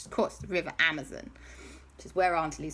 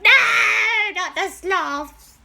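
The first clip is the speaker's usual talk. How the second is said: high, drawn-out mock wail; horrified wail; 9 dB louder, slower than usual. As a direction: wail in hammed-up horror. Auntie Lisa horrified for sloths.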